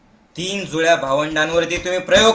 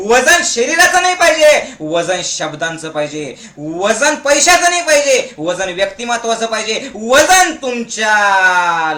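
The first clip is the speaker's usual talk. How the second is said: ordinary talk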